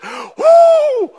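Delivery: high whooping blow, very loud; a huge high wolf's whoosh. Acted. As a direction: blow at the house like the big bad wolf. Wolf blowing down the house.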